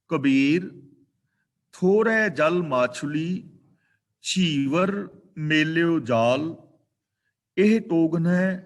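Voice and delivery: loud, very slow verse reading; scripture recitation. Real, not acted. Preacher rereads Kabir's verse for learners, loud slow recitation. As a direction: recite it firmly, drawing out each word.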